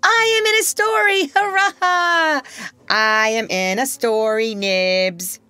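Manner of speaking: thrilled shout. Acted. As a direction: cheer like a delighted boy. Boy thrilled to be in story.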